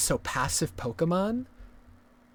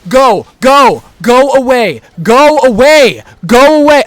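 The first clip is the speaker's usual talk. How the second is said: raging shouts, much higher and louder; furious screaming; 7 semitones higher, 17 dB louder than usual. Real, not acted. Gamer screaming at an aggroed Pokemon, furious. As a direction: scream it away in frustration.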